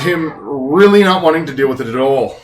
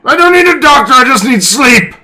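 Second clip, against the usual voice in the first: high, loud slurry yell; drunken shout; 13 semitones higher, 10 dB louder than usual. Acted. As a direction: bellow like a cranky drunk. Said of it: "Are you drunk?".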